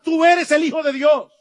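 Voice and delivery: loud, high shout of the demons. Retold or imitated. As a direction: cry it out like possessed men. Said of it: "que le gritaban".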